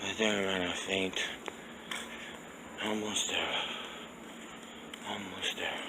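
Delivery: out of breath, voice fading away; exhausted, fading. Real, not acted. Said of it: "I'm out of breath".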